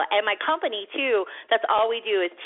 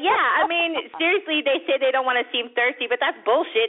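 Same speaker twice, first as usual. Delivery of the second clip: ordinary talk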